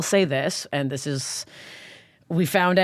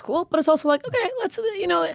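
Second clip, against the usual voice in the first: mock brightness. Real, not acted. Only woman among eight guys.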